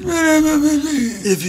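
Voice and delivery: hammed-up mob boss voice; Godfather impression. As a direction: croak it like the Godfather. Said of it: "He just went".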